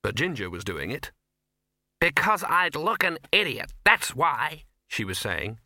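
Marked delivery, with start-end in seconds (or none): 2.0-4.6 louder, higher indignant retort; indignant actress. A narrator's reading. Actress objecting to a romantic role, indignant. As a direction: snap it out in a huff. Angry actress, foot stamping.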